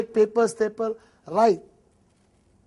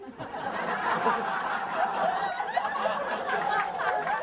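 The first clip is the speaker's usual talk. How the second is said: laugh; 6 dB quieter than usual. Real, not acted. Laughs at own lift line.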